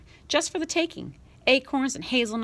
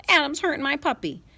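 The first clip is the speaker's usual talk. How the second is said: put-on little girl whine; 7 semitones higher, 3 dB louder than usual. Little sister complaining.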